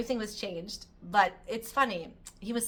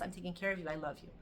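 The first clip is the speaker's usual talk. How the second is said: tender; 5 semitones lower, 10 dB quieter, much faster than usual. Acted. Telling one's body gently.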